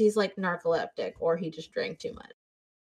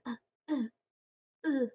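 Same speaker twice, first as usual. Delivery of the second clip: pitched-up, softer mock stammer; awkward stammering; 5 semitones higher, 5 dB quieter, faster than usual. Imitating the man's stammer on the phone, high and soft. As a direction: imitate the tongue-tied man. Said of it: "That's exactly what he sounded like".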